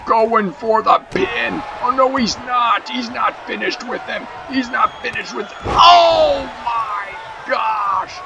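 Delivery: excited play-by-play. Acted. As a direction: call the match like a frantic ringside announcer. Live wrestling play-by-play.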